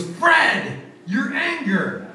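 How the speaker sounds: high, slow, stretched out; drawn-out high guess. Retold or imitated. Host naming Fred's costume.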